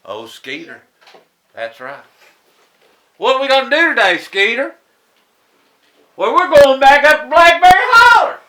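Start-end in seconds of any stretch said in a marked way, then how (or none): 3.2-8.4 high, very loud and eager; excited kids' exchange. Acted. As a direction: pitch it up and belt out the plan. Acted kids planning mischief with Skeeter.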